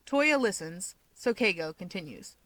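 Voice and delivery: ordinary delivery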